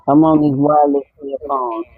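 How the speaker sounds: muffled through earphones; talking through wireless earphones. Real, not acted. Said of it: "You definitely muffled".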